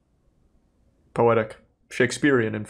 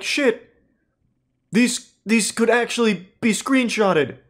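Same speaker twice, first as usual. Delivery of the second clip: panicked realization; 8 semitones higher, slower than usual. Retold or imitated. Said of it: "He starts to recognize, like".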